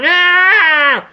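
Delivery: high, loud cartoon villain wail; screeching skull-faced villain. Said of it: "skull-faced man who's constantly going".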